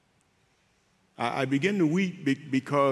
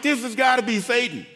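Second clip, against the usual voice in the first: incredulous protest; 7 semitones higher, 3 dB louder than usual. Retold or imitated. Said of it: "And I'm like".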